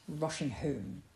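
plain reading voice

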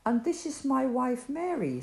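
lightly voiced Joseph greeting shepherds; Joseph's greeting. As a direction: greet the guests gently. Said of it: "said the man".

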